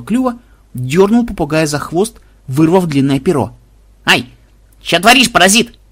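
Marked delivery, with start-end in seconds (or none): 4.0-5.8 loud, higher pained squawk; the bird yelps after losing a feather; yelping parrot. A narrator's reading. Попугаю вырвали перо. (попугай вскрикивает от боли, выше и громче)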